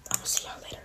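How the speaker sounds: whispered goodbye; whispering. Real, not acted. Whispered sign-off.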